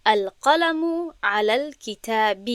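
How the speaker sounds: slow, careful Arabic recitation; Arabic example recited. Shown as a demonstration. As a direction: give «القلم علی الکتاب» slowly, in a reciting voice unlike the talk. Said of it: "مثال".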